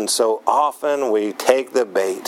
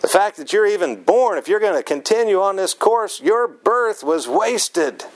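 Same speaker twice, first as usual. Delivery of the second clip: higher-pitched, scolding paraphrase of an enraged king; a preacher's hammed-up rebuke, pitch climbing; mock fury of Saul; 6 semitones higher than usual. Preacher voicing Saul berating his son Jonathan, in higher-pitched mock fury. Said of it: "Do you realize what he's saying".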